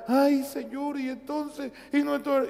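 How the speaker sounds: high, pleading mock prayer; hammed-up anxious prayer for the missionaries; whiny worried prayer. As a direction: imitate a fretful believer begging God. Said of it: "ahí tenemos hermanos nuestros allá".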